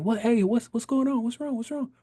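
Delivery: imitating mom asking what's wrong in a rush; worried mom. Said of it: "my mom's like".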